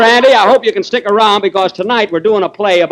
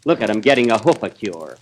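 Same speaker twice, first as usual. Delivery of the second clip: low, soft grumble; catty aside; 8 semitones lower, 6 dB quieter than usual. Acted. Horse gossips about the favorite, low and catty. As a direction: drop it down low and quiet.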